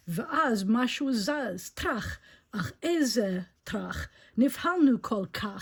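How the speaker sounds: very slow rhyming read; story reading in Hebrew. A narrator's reading. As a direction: savor each Hebrew rhyme. Reads Hebrew rhyming verse.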